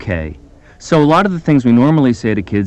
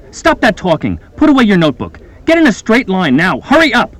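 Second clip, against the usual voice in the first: higher, louder barked commands; bossy orders; 9 semitones higher, 4 dB louder than usual. Acted. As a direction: rap out orders, impatient and bossy. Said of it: "Now I'll issue some orders".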